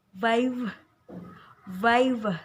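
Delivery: "vive" with a loud, clear ai sound; clear ai diphthong. Shown as a demonstration. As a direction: stretch the ai in "vive". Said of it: "itu cara mempronounskannya adalah". (ucapkan "vive" dengan bunyi ai yang jelas)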